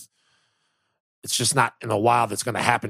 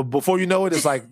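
ordinary talk, nothing marked

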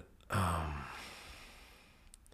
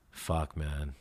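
normal talking voice

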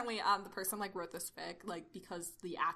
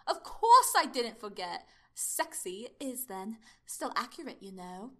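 Doctor character; 9 dB louder, slower than usual. Acted.

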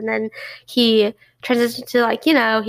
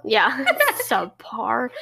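laughing; much slower than usual. Pretend. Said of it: "echoes subpar laughing".

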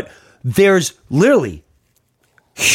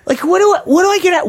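exasperated squeal; much faster than usual. Real, not acted.

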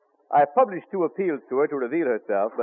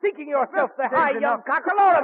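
furious beating; 9 semitones higher than usual. Acted. James beats his apprentice brother Ben with his stick, yelling furiously. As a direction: lash out with each blow.

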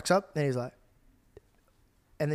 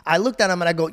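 ordinary delivery

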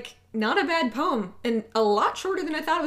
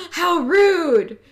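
gasping, higher and louder offended cry; mock offended Scot; 8 semitones higher, 5 dB louder than usual. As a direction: play a scandalised Scot. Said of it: "any Scot that is going to be like".

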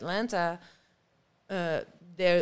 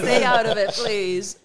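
mock demand for space; 3 semitones higher, 9 dB louder than usual. Acting out guarding personal space.

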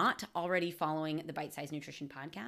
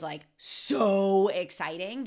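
slow, drawn-out gush on so; excited; 4 dB louder, much slower than usual. Retold or imitated. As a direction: stretch the so with real glee. Gushing about Friendsgiving before contrast.

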